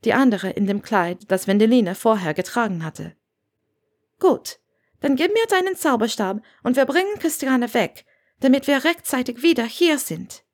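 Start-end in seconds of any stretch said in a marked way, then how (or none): 4.2-10.4 higher woman's character voice; lightly voiced Granger, businesslike. A narrator's reading. Granger asks Wendeline for the wand, lightly voiced and businesslike. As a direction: give instructions in a lightly raised voice.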